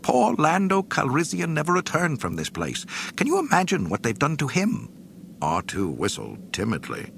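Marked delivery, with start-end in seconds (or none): 0.0-4.9 high, fretful robot voice; fussy droid voice. Acted. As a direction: fuss like a nervous butler droid. C-3PO speaking.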